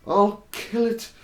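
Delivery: angry rant. Retold or imitated. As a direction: rage at the fly that broke his antique. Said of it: "He got so angry".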